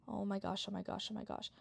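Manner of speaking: hushed excited whisper; whispering while hiding. Real, not acted. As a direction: gasp it under your breath.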